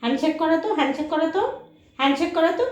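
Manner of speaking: high coaxing command; high sing-song pet request. Real, not acted. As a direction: ask the dog for a paw cheerfully. Asking dog for handshake.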